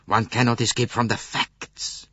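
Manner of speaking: ordinary delivery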